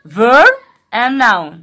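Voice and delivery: teacher stressing terms for the class; slow emphasis. Shown as a demonstration. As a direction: lay out each word for the class. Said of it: "slow emphatic".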